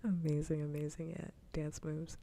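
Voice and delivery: usual speaking voice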